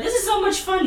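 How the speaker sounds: high, delighted mock gush; gleeful. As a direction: play up her carefree joy. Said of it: "Janine's like".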